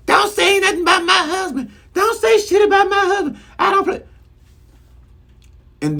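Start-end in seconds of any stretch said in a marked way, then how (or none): 0.1-4.0 going off on her. Retold or imitated. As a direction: shriek it like she's defending her husband. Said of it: "Drew gonna turn around and go off".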